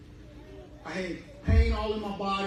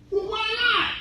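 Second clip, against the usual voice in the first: very high, indignant squeak; belly protesting the refusal; squeaky stomach; whiny stomach; 4 dB louder than usual. Acted. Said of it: "Right now".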